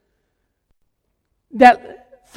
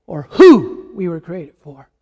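"who" stretched and pitched up, then dropping away; stress on who; 5 semitones lower, 4 dB louder, slower than usual. Real, not acted. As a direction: lean hard on "who", let the rest fall. Corrects "which" to "who.

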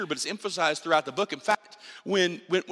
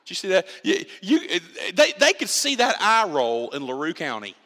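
laughing; 4 dB louder than usual. Real, not acted. Teasing wife's eye roll.

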